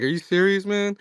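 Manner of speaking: mildly mocked disbelief, pitched well up; retold astonished question; incredulous. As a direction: imitate a brother's incredulous question, rising high. Retelling brother's disbelieving reaction.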